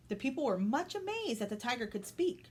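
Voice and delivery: ordinary delivery, nothing marked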